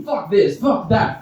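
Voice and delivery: ordinary delivery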